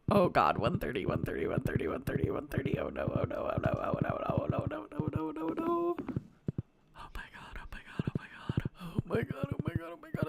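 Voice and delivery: panicked babbling, half whispered; terrified gibbering. Real, not acted. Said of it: "I'm scared".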